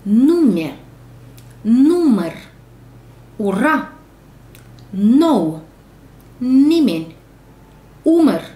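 each word read out loud and clear; teacher modelling words one by one, loud; word drill. Shown as a demonstration. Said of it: "cuvinte, corect".

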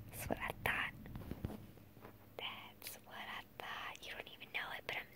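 soft unvoiced whisper, slowing. Real, not acted. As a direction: whisper it close to the mic.

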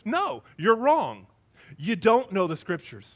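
drawn-out, emphatic no; firm correction. Acted. Jesus answering the Sadducees' question, firm emphatic correction. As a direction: correct them firmly at full voice. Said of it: "And he says".

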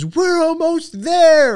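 very high, loud, slow drawn-out announcement; dramatic big reveal. Retold or imitated. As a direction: stretch it out like a game-show reveal. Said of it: "she pauses for effect".

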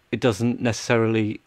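ordinary talk

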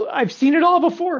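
high, loud theatrical outburst; mock exclamation. Mock wailing boast, far above usual.

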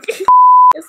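loud, high fangirl shriek-laugh; squealing laugh. Real, not acted. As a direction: let out a shrieking giggle.

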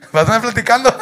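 shouted threat; loud accusation. Acted. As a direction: yell the question. Moreno repite la amenaza. (amenaza gritada)

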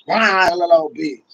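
usual speaking voice

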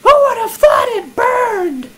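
very high, loud hammed-up scream; mock panic. Player near game fire, hammed-up shrieking panic. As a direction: wail it slowly in fake terror.

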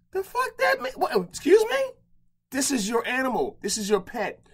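high-pitched disbelief at a careless dog owner; incredulous outrage. Retold or imitated. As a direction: demand an explanation, disbelieving. Retold outburst at owner.

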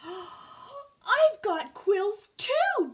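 high, loud proclamation, drawn out on TOO; announcing. A narrator's reading. Hedgehog reveals herself to porcupine, excited announcement. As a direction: announce it triumphantly, stretching the last word. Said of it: "Barb announced".

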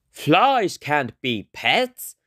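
slow, deliberate dad voice; a father laying down the rule; a hint of a firm father; stern dad. A narrator's reading. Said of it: "said Dad".

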